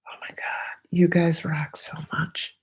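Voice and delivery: excited unvoiced whisper. Real, not acted. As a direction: whisper your delight.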